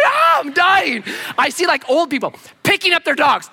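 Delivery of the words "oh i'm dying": high, loud desperate cry; panicked yell. Retold or imitated. Capsized rower yelling toward shore, panicked and loud. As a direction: yell it like the water's closing over you.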